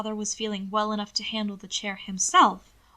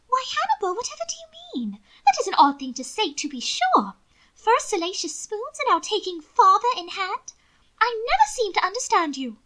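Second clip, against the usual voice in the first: high-pitched fussy society lady, louder; affected lady; 11 semitones higher, 5 dB louder than usual. A narrator's reading. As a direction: prattle on like a vain aristocratic lady.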